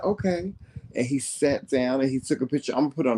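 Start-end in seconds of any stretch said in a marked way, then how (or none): 0.0-0.5 high-pitched voice imitating a small child; little boy's reply. Little boy agreeing to a picture, imitated childlike reply. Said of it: "He said".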